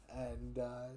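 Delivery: ordinary delivery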